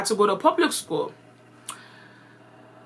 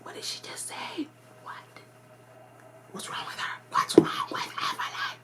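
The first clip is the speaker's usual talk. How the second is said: put-on kids whispering to each other, much softer; 10 semitones higher, 10 dB quieter than usual. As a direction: gossip in a hushed whisper behind her back. Kids gossip about her accent.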